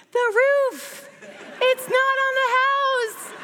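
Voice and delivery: very high, stretched-out exasperated shriek; mock hysterical yell. Wife answering her too-calm husband, hysterical mock yell. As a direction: wail it loudly, dragging the words out. Retells frantic reply to husband.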